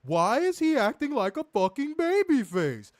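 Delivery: high, loud mock rant; mock-exasperated wrestling-podcast rant; imitating Werner's rant. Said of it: "He sound like Jerry Seinfeld".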